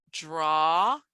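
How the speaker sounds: "draw" with a long AW, rising; slow, careful "draw" ending on AW; AW vowel. Shown as a demonstration. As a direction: hold the vowel of "draw" for comparison. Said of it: "when they're saying words like".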